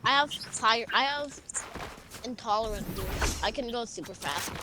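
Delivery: normal talking voice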